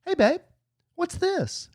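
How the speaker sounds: high-pitched mock sweet question; high, sweet call to partner. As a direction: lilt up high to your partner. Acting himself in retold chat.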